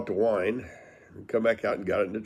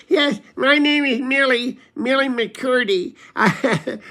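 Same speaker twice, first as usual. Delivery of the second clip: high-pitched, louder mock old woman; Millie's voice; 14 semitones higher, 5 dB louder, slower than usual. Millie McCurdy greeting a visitor, high mock voice. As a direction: pitch way up for a chatty hostess. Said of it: "and she goes".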